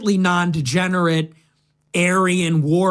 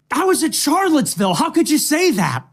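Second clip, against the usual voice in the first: mock indignant; 8 semitones higher than usual. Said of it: "I was like".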